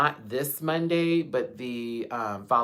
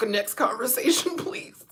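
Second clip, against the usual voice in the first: laughing; 11 semitones higher than usual. Real, not acted. Trying to move on, still laughing.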